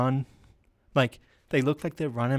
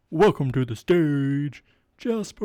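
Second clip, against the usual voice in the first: stage announcer; 5 dB louder, slower than usual. Pretend. Comic introducing their own act to a pretend crowd, big announcer voice. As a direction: announce the act with a drawn-out build.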